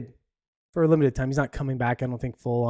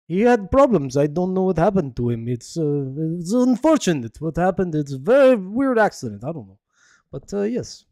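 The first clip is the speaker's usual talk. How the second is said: bad, hammy Russian accent; 4 semitones higher, 3 dB louder than usual. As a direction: put on a clumsy Russian accent. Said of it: "There's my god-awful, uh, Russian accent".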